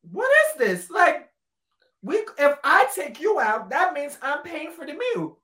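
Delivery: high, loud disbelief; baffled, indignant pitch; incredulous. Retold or imitated. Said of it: "And I was like".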